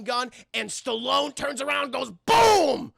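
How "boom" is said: loud, high, explosive boom; shouted gunshot. Retold or imitated. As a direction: blast out an imitation gun going off. Imitates the gun shot.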